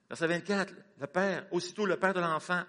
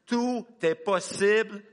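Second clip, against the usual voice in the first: higher, louder, slow, tout hammered; loud slow proclamation; 8 semitones higher, 8 dB louder, much slower than usual. Shown as a demonstration. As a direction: shout it as a rallying cry. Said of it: "Répète ça cette semaine sans cesse".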